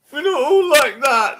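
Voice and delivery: high mock wail. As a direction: whine it like a sulking fan. Mock wailing again.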